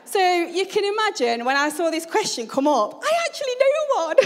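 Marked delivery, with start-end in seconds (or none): 3.0-4.3 high, fast, louder squeal; excited high-pitched rush; really excited. Retold or imitated. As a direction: burst out with giddy excitement at knowing it. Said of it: "I was getting really excited".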